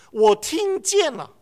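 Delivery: preacher stressing the son's words; slow emphasis. Shown as a demonstration. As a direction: lean on 我听见了 slowly. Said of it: "你注意那个字什么有什么不同呢".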